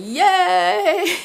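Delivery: loud, high reenacted cheer; high whoop, drawn out; gleeful yelling. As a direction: imitate a long excited yell. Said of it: "And I'm yelling".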